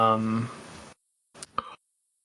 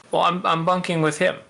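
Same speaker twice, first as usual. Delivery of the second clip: a touch higher, defensive; lightly voiced protest; 6 semitones higher than usual. Sai protests to the elf, lightly defensive. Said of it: "But I am a guest".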